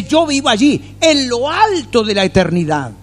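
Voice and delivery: high, majestic proclamation; God's voice. Retold or imitated. God declaring where he lives, in a high, majestic voice. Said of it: "Y dice".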